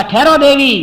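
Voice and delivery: urgent stop. Acted. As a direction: cut her off sharply. Sumantra stops Kaikeyi.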